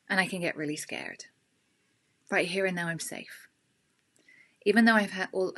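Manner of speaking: guided tapping statements; tapping script. Shown as a demonstration. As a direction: lead the group through each statement calmly. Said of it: "tap along with me and repeat what I say".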